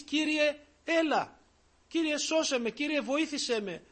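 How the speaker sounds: loud, high pleading call; crying out to the Lord. Pretend. Preacher voicing a believer's plea to God, loud high cry. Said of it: "να πεις".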